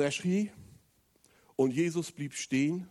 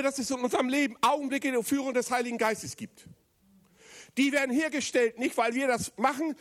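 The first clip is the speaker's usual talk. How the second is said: impassioned sermon voice, raised high; preaching; 9 semitones higher than usual. Acted. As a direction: preach it with full fervour. Preaching at full force. (leidenschaftliche Predigt)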